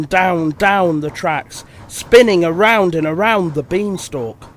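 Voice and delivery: ordinary delivery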